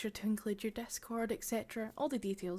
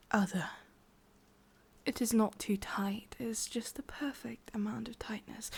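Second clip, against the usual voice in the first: whispering witch. Acted. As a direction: breathe it close to the ear, sweetly menacing. ASMR witch roleplay.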